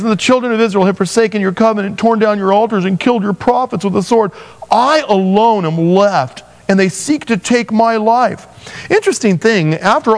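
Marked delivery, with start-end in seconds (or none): 0.0-8.5 higher, self-pitying whine; whiny big baby. Retold or imitated. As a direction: sulk like a hurt child. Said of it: "I have to say it like that because I know he's being a big fat baby".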